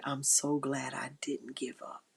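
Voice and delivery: soft whisper, glad you held on; whispering to yourself you're glad you didn't quit; whispered relief. Pretend. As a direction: breathe it like a private thank-you. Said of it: "the days when you say".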